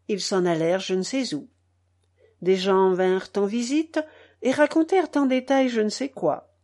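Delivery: ordinary delivery, nothing marked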